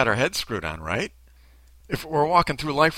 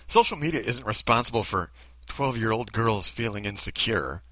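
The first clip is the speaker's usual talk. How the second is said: ordinary talk, nothing marked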